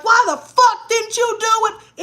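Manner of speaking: put-on high, loud angry yell at a kid; mock cussing out. Mom pretending to yell at a kid who skipped chores, loud and high. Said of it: "instead of cussing a kid out".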